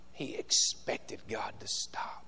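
hushed whisper. Real, not acted. Preacher whispering to the church after shouting, hushed. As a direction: drop to a whisper for effect. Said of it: "whispered after the shout".

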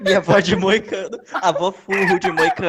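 laughing. Real, not acted. Friends laughing, repeating a misheard phrase.

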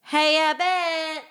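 slow, high, loud call. Retold or imitated. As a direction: yell it across the room. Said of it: "the guy that screamed out".

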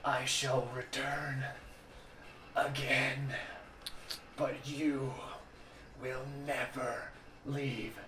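slow, halting raspy villain; broken raspy voice. Acted. Broken villain vowing return from over the top, raspy and halting. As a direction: rasp out a broken threat, pausing between phrases. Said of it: "broken, like, raspy voice".

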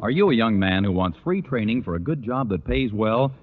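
ordinary talk

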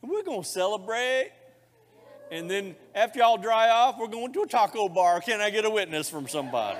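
loud, high, joyful call; excited rally. Real, not acted. Pastor announcing the celebration to the congregation, loud and joyful. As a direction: rouse the crowd.

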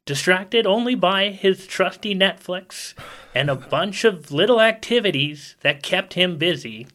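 normal talking voice

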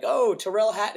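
high, loud mock interviewer saying "Tyrrell" wrong; eager mispronounced "Oh, Tyrrell Hatton"; mispronouncing Tyrrell. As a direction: call him "Tyrrell Hatton" like a clueless reporter. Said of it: "I've seen so many videos where they're like".

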